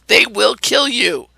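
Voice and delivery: ordinary talk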